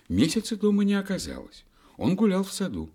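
high, lively storytelling voice; reading to a toddler. A narrator's reading. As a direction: read it like to a small child.

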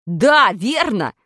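loud, drawn-out low agreement; excited agreement. A narrator's reading. Pashka jumping at the idea, loud agreement. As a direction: agree loudly, stretching the words. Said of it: "подскочил на месте Пашка".

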